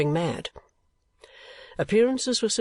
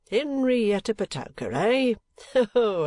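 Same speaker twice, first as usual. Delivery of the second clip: high, amused surprise; chuckling; 9 semitones higher, slower than usual. A narrator's reading. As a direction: bubble up with a little laugh. Kenwigs chuckling over old friend.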